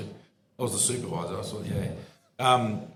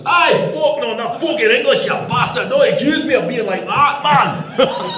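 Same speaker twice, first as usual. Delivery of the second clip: loud, high, outraged Scottish rant; hammed-up Scottish shouting; big Scotsman; 13 semitones higher, 11 dB louder than usual. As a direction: imitate an offended Scotsman yelling. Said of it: "it's a big Scotsman".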